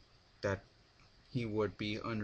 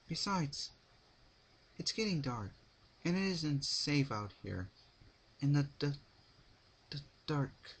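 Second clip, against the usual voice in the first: nervous gulp; 6 semitones higher than usual. A narrator's reading. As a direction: stutter on dark with fear. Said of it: "she gulped".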